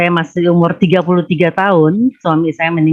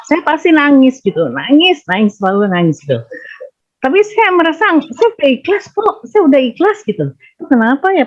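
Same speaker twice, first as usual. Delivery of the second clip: high, wavering plea on the verge of tears; tearful; 7 semitones higher than usual. Real, not acted. Widow confiding to her teacher that she cries praying, tearful. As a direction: let the pitch climb as if tears are coming. Widow telling of crying in prayer.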